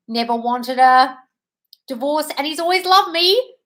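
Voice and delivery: thrilled, voice climbing; excited reading. Real, not acted. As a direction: build up in real excitement as you read. Reading a thrilling comment aloud.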